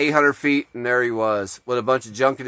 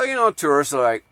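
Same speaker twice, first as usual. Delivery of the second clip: usual speaking voice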